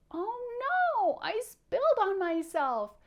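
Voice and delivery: high, loud wailing bug puppet; pouty wail. Pretend. Teacher voicing Powder, who spilled chocolate milk, in a pouty wail. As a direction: wail it like an upset little kid. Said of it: "pouts and frowns and cries about everything".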